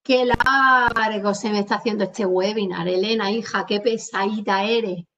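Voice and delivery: "largo" with a hugely stretched a; elongated vowel. Shown as a demonstration. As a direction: drag out the a in "largo" as if it goes on forever. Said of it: "Yo alargaría la vocal".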